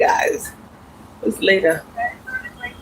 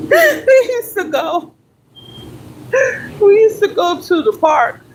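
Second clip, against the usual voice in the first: laughing; 13 semitones higher than usual. Real, not acted. Fond memory of son, laughing.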